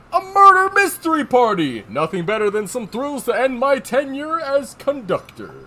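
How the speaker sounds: loud, high, hearty announcement of a treat; lightly voiced cheerful train conductor; excited conductor. Conductor welcoming staff to his last event, excited.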